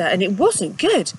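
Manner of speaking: ordinary delivery, nothing marked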